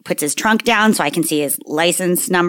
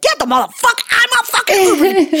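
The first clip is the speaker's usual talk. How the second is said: high-pitched hammed-up rage, laughing; screechy retold outburst on the edge of laughter; mock scream; 13 semitones higher than usual. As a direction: imitate a furious scream for laughs. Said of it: "You screamed it".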